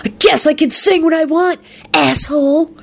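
high, insolent comeback; defiant snarl. A narrator's reading. Drunk singer answering angry host, snarling defiantly. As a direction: talk back defiantly. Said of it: "Carmine snarled defiantly".

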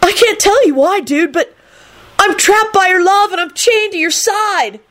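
very high, fervent love declaration; happy yelp. A narrator's reading. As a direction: declare it in a smitten squeal. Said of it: "Kenny yelped happily".

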